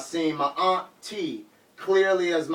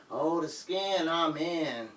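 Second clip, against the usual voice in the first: quiet [name] lyric; 9 dB quieter, slower than usual. Retold or imitated. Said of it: "What [name] say?".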